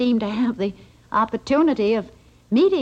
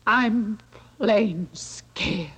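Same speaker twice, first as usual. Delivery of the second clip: scared; 3 semitones lower, much slower than usual. Acted. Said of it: "I'm scared".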